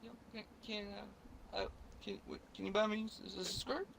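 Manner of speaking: put-on girlfriend voice. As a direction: ask sweetly and hesitantly like the girlfriend. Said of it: "she was like".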